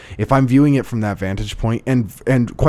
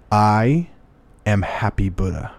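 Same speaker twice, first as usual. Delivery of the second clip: slow, spaced-out words in imitation of a monk; measured monk's reply; slower than usual. Monk answering the angry man across the aisle, slow and deliberate. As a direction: pause after each phrase, letting it land like a teaching.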